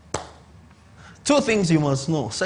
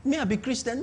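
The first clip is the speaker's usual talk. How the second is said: higher, softer, wondering; lightly voiced scrupulous believer; mock pious doubt; 6 semitones higher, 7 dB quieter than usual. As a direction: mimic a timid inner voice. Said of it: "somewhere in your mind, like".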